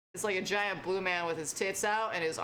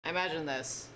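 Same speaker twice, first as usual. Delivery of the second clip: usual speaking voice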